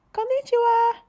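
put-on high, bright child's greeting. Girl answering the restaurant owner, high child voice. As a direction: say it back like a little girl.

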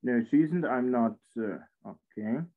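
ordinary delivery

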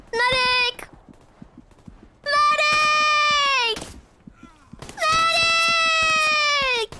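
high desperate calls for medic; man down shout. Pretend. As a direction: yell for a medic, rising. Calling for medic in battle.

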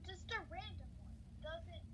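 high, soft teasing parody voice; mocking imitation. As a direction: mimic the teacher in a silly high voice.

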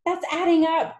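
high, loud cry of protest; distressed schoolboy cry; Wilfred. A narrator's reading. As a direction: protest in a flustered squeal. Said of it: "Wilfred cried".

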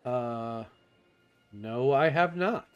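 ordinary delivery

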